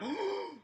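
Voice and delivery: high, hammed-up gasp of surprise; mock shocked gasp. Fan imitating a reaction to a surprise debut, mock gasp. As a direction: gasp as if stunned, playing it up. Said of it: "I wouldn't be like".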